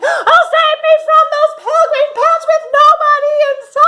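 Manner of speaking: high, loud, sing-song wail for help; crying for help. Acted. Begging to be saved from the pants, high loud wail. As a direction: wail it out like a terrified hero. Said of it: "I cried".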